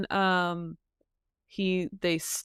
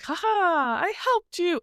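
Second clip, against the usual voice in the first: high, gleeful mock boast; cheerful rescuer; 9 semitones higher than usual. Hunthor gloating to Turin after saving him, high and gleeful. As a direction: crow a proud little victory, pitched high. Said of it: "and he's like".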